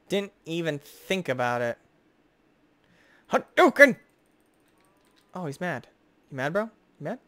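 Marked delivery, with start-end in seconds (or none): 3.3-4.0 high, loud special-move shout; fighting-game battle cry. Pretend. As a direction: yell it like a Street Fighter attack. Fireball attack, game move.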